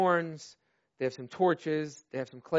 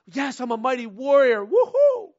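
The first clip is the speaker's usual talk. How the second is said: high, loud cheerleader rah-rah; mock hype; 6 semitones higher, 7 dB louder than usual. Mocking a congregation hyping itself up, loud and high. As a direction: ham up shallow churchy excitement. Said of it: "it'd be easy for us to, like".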